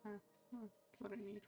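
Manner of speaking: normal talking voice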